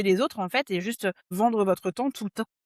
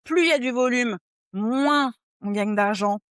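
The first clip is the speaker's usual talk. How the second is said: drawn-out words, lightly mimicking a sales line; slightly raised, slow mock-quote delivery; quoted contract pitch; 3 semitones higher, 4 dB louder than usual. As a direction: stretch it out like a recited pitch. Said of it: "de contrat".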